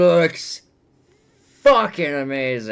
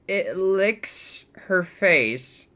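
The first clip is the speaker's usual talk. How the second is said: normal talking voice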